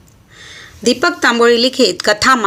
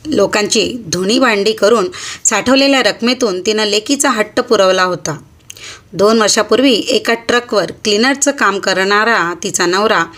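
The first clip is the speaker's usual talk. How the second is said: ordinary delivery, nothing marked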